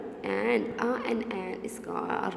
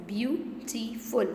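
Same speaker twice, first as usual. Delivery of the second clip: "Beautiful" slowly as beau, ti, ful; teacher sounding out "Beautiful" slowly; split into syllables; slower than usual. Shown as a demonstration. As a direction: say "Beautiful" in three separate beats. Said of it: "Three syllables".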